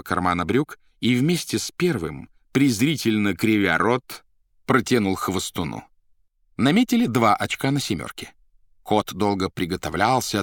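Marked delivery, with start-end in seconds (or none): none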